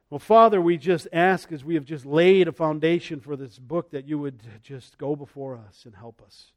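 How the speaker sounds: earnest closing prayer. Acted. Pastor praying over the congregation before the study, earnest. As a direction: lead the church in humble prayer.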